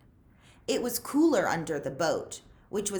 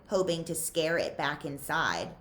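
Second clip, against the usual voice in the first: ordinary delivery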